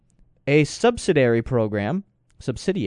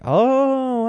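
surprised oh; 13 semitones higher, 4 dB louder than usual. Retold or imitated. Wife reacting to felony stop.

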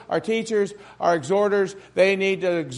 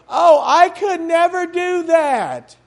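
higher, slow whiny protest; mock timid refusal; 8 semitones higher, 5 dB louder, slower than usual. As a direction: play the reluctant helper begging off. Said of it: "And they go".

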